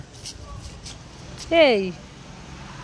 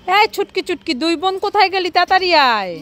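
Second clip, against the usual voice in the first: raised, higher summoning call; 4 semitones higher, 4 dB louder than usual. Acted. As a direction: call the children in from afar. Calling the sisters to come.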